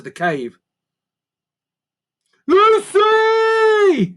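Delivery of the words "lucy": very high, loud call from far below; distant shout. A narrator's reading. Boy calls Lucy from the world above, shouting. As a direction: shout her name up the hole. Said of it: "Norman's voice soared up".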